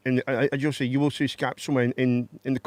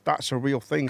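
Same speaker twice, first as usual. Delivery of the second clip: ordinary talk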